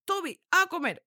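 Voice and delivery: high, sharp calls to a dog; dog-training command. Shown as a demonstration. Trainer addressing Toby when he misbehaves, high commanding voice. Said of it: "pero si Toby se porta mal, le digo".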